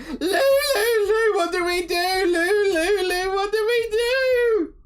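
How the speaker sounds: very high, wailing sing-song fretting; loud, high-pitched cartoon fluster; panicking little creature. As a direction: flap about in a squealing panic. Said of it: "rushing around the ship and is like".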